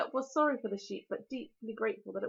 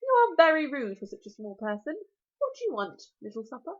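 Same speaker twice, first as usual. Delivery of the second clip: louder, sharp exasperated dragon; 2 semitones higher, 8 dB louder than usual. Acted. Dragon snaps at small rude Hiccup, exasperated. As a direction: scold the rude little thing. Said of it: "He sounded a bit exasperated now".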